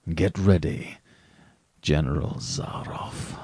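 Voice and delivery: slow, hushed and hoarse menace; low hoarse voice. A narrator's reading. Rainsford warns General Zaroff to get ready, low and hoarse. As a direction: rasp it out low, almost whispered. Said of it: "in a low, hoarse voice".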